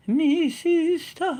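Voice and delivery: put-on high, loud old nanny voice; Mrs Doubtfire voice. Shown as a demonstration. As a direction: do the old Scottish nanny, grandly. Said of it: "repeats name in different voices".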